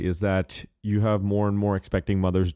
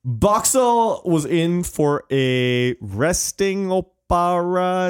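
slow, drawn-out commentator voice; Jeff Reuter impression; 7 semitones higher, much slower than usual. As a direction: stretch it like a TV pundit. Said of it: "as Jeff Reuter said".

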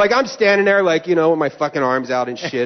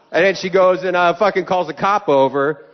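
ordinary delivery

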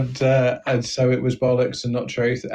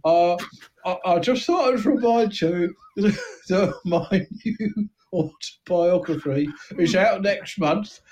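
a small-time crook boasting, thin Cockney voice; East End gangster. Acted. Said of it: "I'm searching for the right note for this voice".

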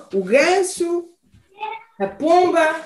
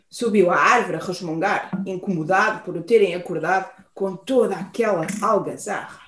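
plain reading voice

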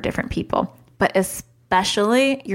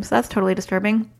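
usual speaking voice

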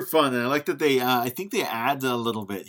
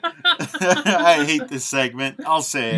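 laughing embarrassed; 5 semitones higher, 6 dB louder, slower than usual. Real, not acted. Laughing at his own bad impression.

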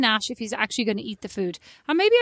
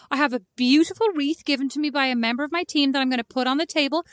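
usual speaking voice

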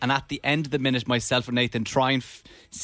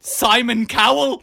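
high, loud, drawn-out shout; slow incredulous shout; shocked guess; 9 semitones higher, 7 dB louder, slower than usual. Real, not acted. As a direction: blurt the name out in disbelief. Blurts the shocked winning guess.

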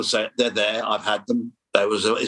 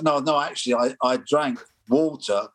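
usual speaking voice

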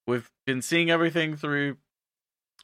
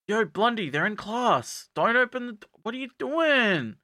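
mock scolding; 9 semitones higher than usual. [name] to Blondie slamming open classroom doors, exasperated. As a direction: scold a friend in a high, stretched voice.

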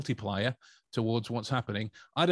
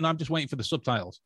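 quick retort; 3 semitones higher, much faster than usual. Retold or imitated. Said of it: "Just staring at their stomach".

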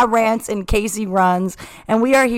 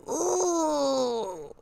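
pained ooh; 4 semitones higher, 10 dB quieter than usual. Retold or imitated. Reacting to the accent.

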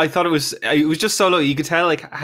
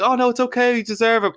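high, sugary reassurance, imitating the group; mock reassuring; 11 semitones higher than usual. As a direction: coo it like fake encouragement. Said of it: "being like".

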